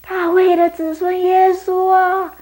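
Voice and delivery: high, loud, slow plea; teacher playing Bartimaeus, calling loud and high; loud cry. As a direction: cry out with full strength. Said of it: "假装我是巴迪买，就是叫得很大声".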